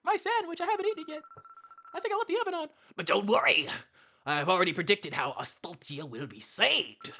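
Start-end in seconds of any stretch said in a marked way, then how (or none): 0.0-2.7 much higher, hammed-up panic; mock panicked crowd. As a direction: squeal like a frantic villager. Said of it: "Everyone's like".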